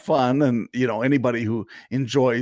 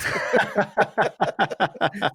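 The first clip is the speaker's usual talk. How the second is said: high burst of laughter; 10 semitones higher than usual. Real, not acted. Laughing at own punchline.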